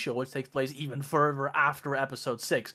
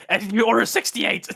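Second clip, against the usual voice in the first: higher, louder put-on Emperor voice; mock Emperor command; 5 semitones higher, 7 dB louder than usual. Emperor calling out the wrong order, louder and higher. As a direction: bark the order like a cartoon Emperor.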